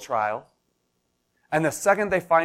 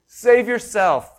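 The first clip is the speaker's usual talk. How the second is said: louder, higher, slow mocking yell; jeering crowd; 7 semitones higher, 4 dB louder than usual. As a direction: taunt him like a bitter mob. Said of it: "they were yelling".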